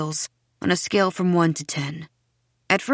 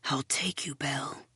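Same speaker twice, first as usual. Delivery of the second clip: low, very soft whisper; 4 semitones lower, 11 dB quieter than usual. A narrator's reading. Seth promising Belle to take her away, whispered. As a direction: murmur a secret promise. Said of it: "he whispers".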